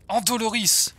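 high, loud spell cry; shouted curse. Acted. Torturer casts a curse on the captive, shouting. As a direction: hurl the curse at full voice.

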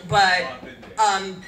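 ordinary delivery

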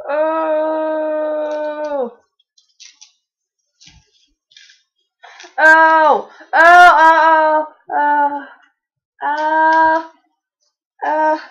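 loud pained oh cries; real pain, crying out loud; pained groans. Real, not acted. Pulling tape off face.

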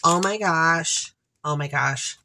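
usual speaking voice

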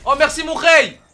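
high, loud grateful shout; excited thanks. Real, not acted. Streamer thanks viewer who gave his kill, excited shout. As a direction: burst out thanking him.

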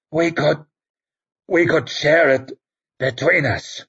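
a hint of a bleeding old priest bargaining; wounded old priest. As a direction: tempt him with the dragon's power, fading. Wounded Werdenur pleads with Koska.

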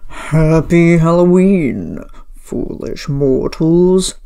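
loud, slow villain greeting; dark lord. Shown as a demonstration. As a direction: boom it like a theatrical villain. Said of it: "A little villainous for you".

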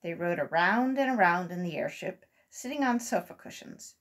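ordinary delivery

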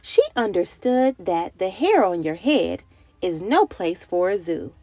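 lively, sing-song picture-book reading; reading to children. A narrator's reading. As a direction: read it like story time for little kids.

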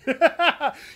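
high giggle at his own impression; real laugh. Real, not acted. Streamer cracking up after his impression, high laugh. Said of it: "How was that, chat?".

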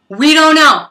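loud, high outburst; loud exclaim. Real, not acted. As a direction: burst out with it. Live reaction, exclaiming.

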